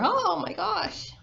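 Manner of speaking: high surprised cry; the birds shrieking in surprise at the alligator; startled birds. A narrator's reading.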